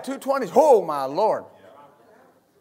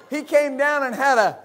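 ordinary delivery

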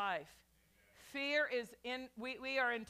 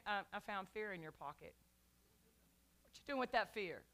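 softer, lower mock guard voice; lightly voiced guard; 6 semitones lower, 9 dB quieter, faster than usual. Preacher voicing Holy Spirit as a frisking guard, soft and dry.

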